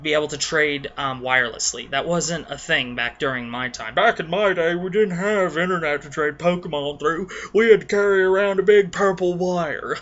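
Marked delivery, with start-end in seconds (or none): 3.9-10.0 mock old-timer grumble, raised and louder. Grumbling to young gamers about trade cables, hammed-up old-timer. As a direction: put on an old codger reminiscing loudly. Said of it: "God, I sound so old".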